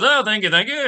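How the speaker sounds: light Faldo impression, polite and repeated; imitating Faldo. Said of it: "he always says everything twice".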